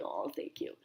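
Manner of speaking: whispered teary thanks; tearful whisper. Retold or imitated. Crying mom thanking kind ladies, in a whisper. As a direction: barely get the thanks out through tears. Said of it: "And I was like".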